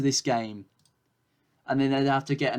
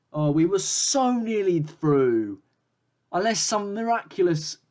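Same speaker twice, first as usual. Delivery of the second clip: dismayed lament; 6 semitones higher, 4 dB louder than usual. Real, not acted. Lamenting elimination.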